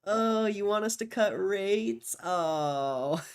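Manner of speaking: drawn-out, high, loud teasing voice; slow mock-pity, pitched far up; mock Jerome Powell. Streamer as Jerome Powell taunting rate-cut hopefuls, mocking.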